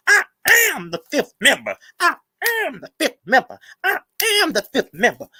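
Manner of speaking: hammed-up imitation, high and mocking; mocking impression. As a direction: parrot her pompously. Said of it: "she kept on saying how she the fifth member".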